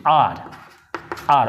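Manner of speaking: "आर" said low, one open syllable with no "आवर"; demo our as ar. Shown as a demonstration. Teacher correcting "आवर" to a low "आर". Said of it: "आवर भी नहीं".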